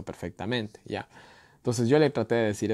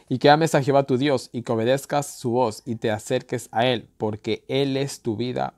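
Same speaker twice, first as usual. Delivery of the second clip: louder reading of the final verse; scripture reading; 2 semitones higher, 5 dB louder than usual. Acted. Preacher reading the final verse to viewers, solemn. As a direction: exhort them to love God.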